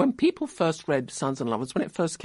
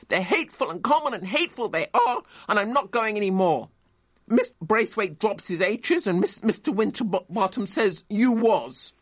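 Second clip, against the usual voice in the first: put-on higher, faster indignant boy's outburst; a child's higher furious complaint; angry child; 7 semitones higher than usual. A narrator's reading. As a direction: rant like a furious schoolboy. Boy raging at his mother.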